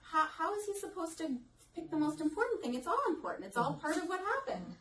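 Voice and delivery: light imitation of a child's earnest reasoning. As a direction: speak as the boy who thinks every detail matters. Voicing the child's inner logic.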